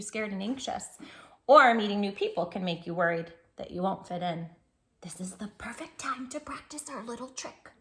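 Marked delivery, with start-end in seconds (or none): 5.0-7.7 conspiratorial whisper to little listeners. Acted. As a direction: lean in and share a secret. Confiding the trick to kids.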